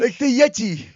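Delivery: loud, high cartoon yeti voice; Yeti-like British-American impression; [name]. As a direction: blurt it in a comic put-on voice. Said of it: "you sound like a mix of the Yeti".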